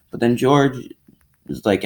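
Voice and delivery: normal talking voice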